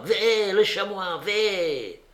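high-pitched cry of discovery; excited cry. A narrator's reading. Pascalon spots a chamois and cries out to the party. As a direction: call out the sighting in a high voice. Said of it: "Pascalon cria".

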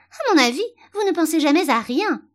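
high, loud, cutting reproach; sharp put-down; severe tone. A narrator's reading. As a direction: scold her as a stern, superior rose. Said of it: "d'un ton plutôt sévère".

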